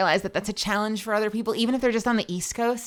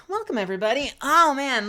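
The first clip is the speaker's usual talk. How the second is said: ordinary delivery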